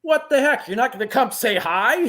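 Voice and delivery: high, loud mock-indignant call; playfully indignant friend; mock offended. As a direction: pretend to be hurt he didn't come over. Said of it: "It's like".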